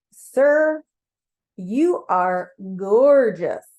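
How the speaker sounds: loud, very drawn-out gushing, hammed-up; emphatic admiration. You insisting to Mars on his beauty, emphatically awestruck. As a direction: insist on it, stretching each word out with force. Repeats the compliment, more insistently.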